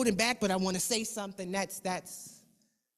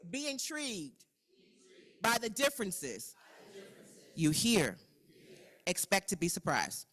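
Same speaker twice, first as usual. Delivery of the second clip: call-and-repeat reading; 3 semitones higher than usual. Acted. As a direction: lead the group through a poem line by line. Leads choral reading of norms.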